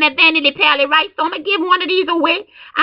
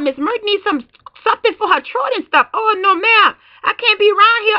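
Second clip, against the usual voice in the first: usual speaking voice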